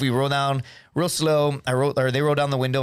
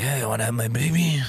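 low, put-on voice with a speech impediment; lightly voiced drunk pick-up line in an impeded voice; alter ego; 4 semitones lower than usual. Drunk alter ego walking up to girls, put-on impeded voice. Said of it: "my alter ego has a speech impediment".

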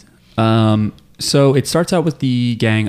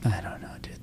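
real hushed whisper, no voice; 15 dB quieter than usual. Real, not acted. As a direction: whisper it so the neighbours can't hear. Aside while the baby is heard.

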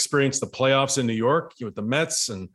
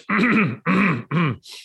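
signalling throat clear; 6 semitones higher than usual. Retold or imitated. Catcher behind the batter tipping the pitch, signalling throat clear. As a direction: imitate a pointed ahem from behind, several times over. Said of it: "I hear behind me".

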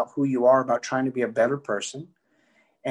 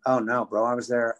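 usual speaking voice

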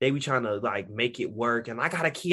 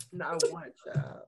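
whispered lament; 3 semitones higher, 6 dB quieter, slower than usual. Acted. Laments long dry spell.

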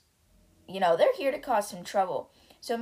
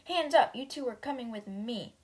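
sudden command; 4 semitones higher than usual. Acted. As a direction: stop them cold. Deputy ambushing them.

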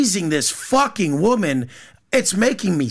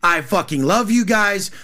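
usual speaking voice

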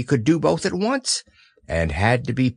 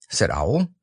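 plain reading voice

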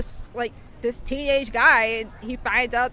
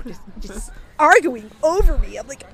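ordinary talk

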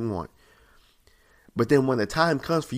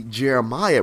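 ordinary talk, nothing marked

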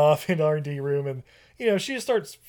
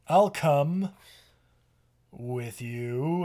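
creepy line reading; much slower than usual. Retold or imitated. Captain accepting Claire's invitation, creepy pause. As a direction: leave an unsettling gap before with you. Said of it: "why did you say it that way".

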